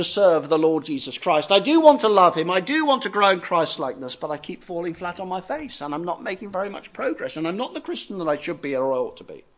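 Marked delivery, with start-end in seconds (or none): 4.2-9.4 soft, subdued admission of failure; discouraged confession. Acted. Believer confessing he falls short, discouraged. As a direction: let the voice drop into discouragement.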